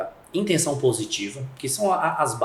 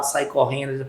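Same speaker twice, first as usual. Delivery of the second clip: ordinary talk, nothing marked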